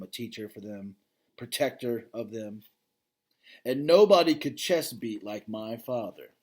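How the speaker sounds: plain reading voice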